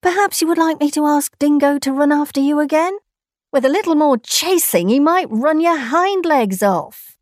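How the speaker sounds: lightly voiced menace; menacing threat. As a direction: threaten the kangaroo playfully. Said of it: "growled Nakwang".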